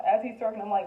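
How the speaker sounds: ordinary talk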